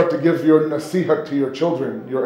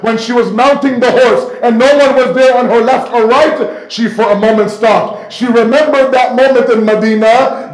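higher, louder, impassioned sermon climax; preaching at full force; 7 semitones higher, 5 dB louder than usual. Acted. Preacher to mourners, at the passionate climax. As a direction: build the mourners to a peak of grief.

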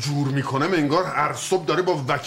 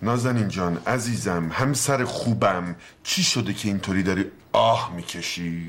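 lower, slow and gentle; tender coaxing; 7 semitones lower than usual. Acted. Husband gently coaxes his sighing wife, tender and slow. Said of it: "اینجوری میپرسم؟".

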